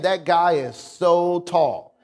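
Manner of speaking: "so" stretched out long for emphasis; stress on "so". Shown as a demonstration. Preacher shows emphasis to the congregation, stress on "so". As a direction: lean slowly on "so" before "tall". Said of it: "you placed an emphasis on how tall".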